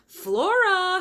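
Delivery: high, loud call upstairs; mother calling up to her daughter; high, loud shout of the name. A narrator's reading. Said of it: "her mother shouted".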